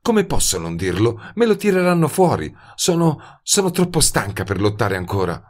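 too tired to fight, drifting off; sleepy and tired. A narrator's reading. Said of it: "disse assonnata". (assonnata e sfinita, voce più acuta)

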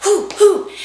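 loud high hoots while jumping; energetic hoots. Shown as a demonstration. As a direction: pump yourself up like an athlete. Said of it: "warm up ... athletic event".

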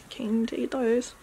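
ordinary delivery

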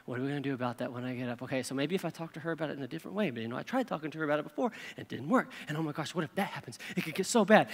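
hushed, fast, low worried rambling; anxious night muttering. Acted. Lying awake at night with a spinning brain, fretting in an undertone. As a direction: race softly through worries under your breath.

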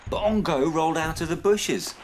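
animated storytelling with lively character voices; children's story reading. A narrator's reading. Narrator telling children about Snip's dad, animated storytelling. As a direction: read a picture book aloud with expressive swoops. Reads a picture book to children.

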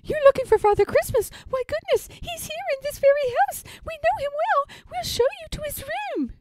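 very high, eager mouse voice; tiny high-pitched storybook mice; chorusing little mice. A narrator's reading. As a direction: squeak it out like excited mice. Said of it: "chorused the three little mice".

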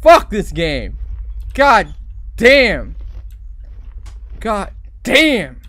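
loud outburst, punching each curse; exasperated cursing. Real, not acted. Player fed up with the horror game, exasperated cursing. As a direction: shout curses after a bad scare.

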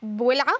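"بولعة" for bisor'a, middle sound lost; mispronounced child word. Retold or imitated. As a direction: imitate a child's wrong "بولعة". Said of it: "الكلمات بينطقها غلط". (نطق طفولي غلط "بولعة")

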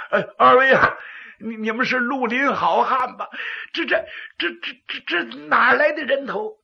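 stammering in fear. Acted. Terrified stammering magistrate.

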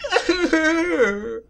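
high cackling laugh; loud high laughter. Real, not acted. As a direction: burst out laughing.

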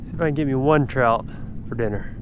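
ordinary talk, nothing marked

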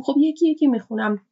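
ordinary delivery

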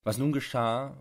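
ordinary delivery

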